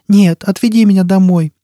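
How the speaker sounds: put-on high child's plea; pleading child. A narrator's reading. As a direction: beg as a frightened small child. Child begs to go home.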